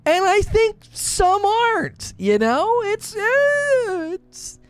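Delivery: high-pitched, stretched-out mock diplomacy; exaggerated hedging. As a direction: tiptoe round it in a squeaky, slow voice. Hedging on whether Brits are mourning.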